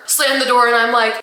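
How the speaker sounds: ordinary talk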